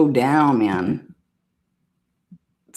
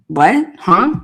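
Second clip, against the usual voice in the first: ordinary talk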